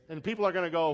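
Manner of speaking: normal talking voice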